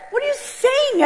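high, incredulous question; can't believe what she's hearing; stunned disbelief. Real, not acted. Disbelief at clinic news.

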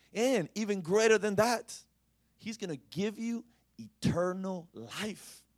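loud, rising, slowed proclamation; preaching at full force. Acted. Preacher proclaiming the reward to the congregation, loud.

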